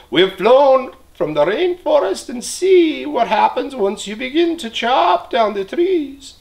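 a toucan warning the man, high and bright. Acted. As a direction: speak like a squawking toucan. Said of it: "squawked the toucan".

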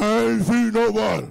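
loud, low mock denial; deep voice. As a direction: imitate her denial in a deep, booming voice. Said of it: "She done got deeper".

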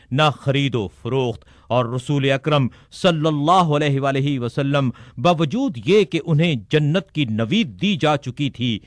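usual speaking voice

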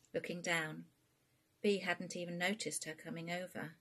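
plain reading voice

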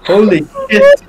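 high astonished cry. Real, not acted. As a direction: blurt out amazed. Astonished yells.

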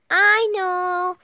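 loud, high "I know"; impatient kid's loud retort; crying out. A narrator's reading. Froggy's repeated retort to father.

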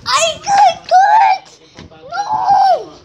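high, slow shout of alarm. Real, not acted. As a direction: yell it out when you're caught. Caught in the game, cries out.

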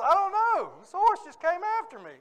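very high, loud, panicked mock whine; helpless teenager's wail, played for laughs; hammed-up near-tearful protest; trying not to cry. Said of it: "I'm trying not to cry".

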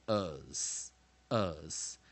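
"us" slow, each one separated; weak-syllable ending. Shown as a demonstration. Teacher modelling the weak OUS ending, "us" slow and deliberate. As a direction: draw out "us" to demonstrate the suffix. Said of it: "所以这边念".

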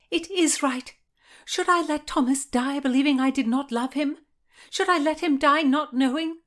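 high, loud, impassioned outburst; desperate protest. A narrator's reading. Anne insists to Margaret on writing Thomas, desperate protest. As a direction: cry it out in high indignation.

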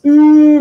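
high loud ooh of dismay; loud high groan; pained ooh. Real, not acted. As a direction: react to a painful mistake. Dismay at mistake.

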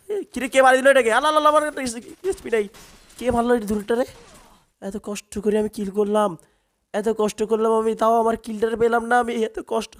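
indignant complaint. Real, not acted. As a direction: protest the stolen kill. Kill stolen, outraged complaint. (কিল চুরি হওয়ায় উঁচু গলায় ক্ষুব্ধ অভিযোগ)

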